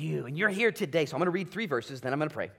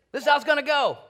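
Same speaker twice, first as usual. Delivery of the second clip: much higher and louder; proclaiming; 12 semitones higher, 10 dB louder, faster than usual. Acted. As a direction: thunder God's decree. Voicing God's decree.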